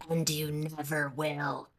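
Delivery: low, soft, slow sinister gremlin. Retold or imitated. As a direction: drop into a creepy little brain-gremlin murmur. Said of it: "the little gremlin in my brain is like".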